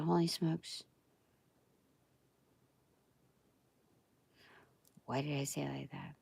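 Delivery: cringing at her own voice under her breath; embarrassed mutter. Real, not acted.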